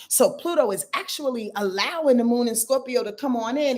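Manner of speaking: normal talking voice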